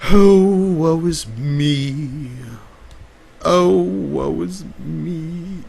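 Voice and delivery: slow, loud theatrical wail of woe; drawn-out mock grief; hammed-up lament. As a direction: overplay the despair like a tragic stage hero. Melodramatic lament over no chatters.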